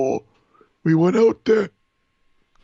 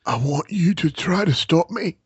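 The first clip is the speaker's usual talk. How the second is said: normal talking voice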